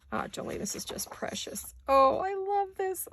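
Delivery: soft, breathless whisper of delight; awed whisper. Real, not acted. Vlogger whispering her delight at Jolene's kit page. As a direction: whisper it as if the page is too precious to speak over.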